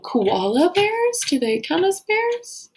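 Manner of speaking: high, drawn-out questioning of koalas; playfully unsure, asking the class; puzzled wondering. Acted. Teacher musing to the class, high and puzzled.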